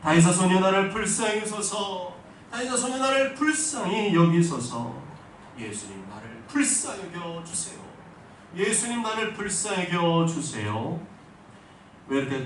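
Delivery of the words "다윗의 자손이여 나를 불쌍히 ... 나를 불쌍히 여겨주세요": a much louder, higher shout for mercy; full-voiced desperate shout, the last plea slowed; louder cry. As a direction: cry out even harder over the scolding crowd. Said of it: "더 큰 소리로 부르짖었습니다".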